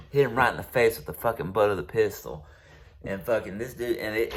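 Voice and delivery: normal talking voice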